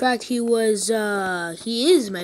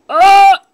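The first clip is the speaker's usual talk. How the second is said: loud yelp; 10 semitones higher, 16 dB louder than usual. Real, not acted.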